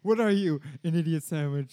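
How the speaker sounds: loud mocking chef rant; furious chef. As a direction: scold like an outraged head chef. Gordon Ramsay impression.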